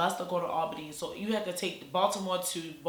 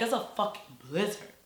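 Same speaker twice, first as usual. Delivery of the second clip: ordinary delivery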